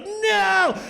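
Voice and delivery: high, loud comic wail of "no"; hammed-up drawn-out scream; terrified seal's cry. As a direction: cry out as the doomed seal. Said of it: "you see this look on this".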